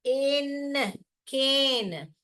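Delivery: each sound drawn out slowly. Shown as a demonstration. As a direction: stretch every letter sound, very slow. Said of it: "कैन बन गया केन". (अक्षर धीरे-धीरे खींचकर)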